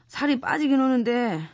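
low, nauseous groaning; queasy, sick voice. Acted. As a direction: sound sick and dizzy. Sick and dizzy from pills.

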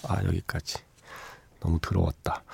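soft sheepish aside; embarrassed mutter. Real, not acted. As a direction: trail off, a little ashamed.

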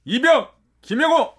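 shouted military salute; korean military speech. Acted. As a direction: bark it like a new recruit. New recruit reporting to superior.

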